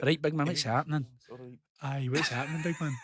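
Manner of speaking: casual Scottish greeting; Scottish accent. Shown as a demonstration. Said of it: "I have to say something in Scottish".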